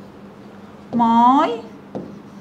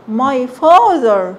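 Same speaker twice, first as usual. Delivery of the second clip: very slowly, each word drawn out; slow demo; 4 dB louder, much slower than usual. Shown as a demonstration. Teacher drills pupils on my father, slowly. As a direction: model it slowly for the class.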